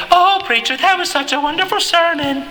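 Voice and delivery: high, gushing imitation of a churchgoer. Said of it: "I remember people walking up".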